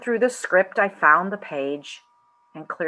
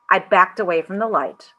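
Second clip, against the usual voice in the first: plain reading voice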